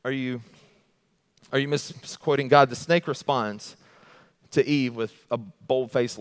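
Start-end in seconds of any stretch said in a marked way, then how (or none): none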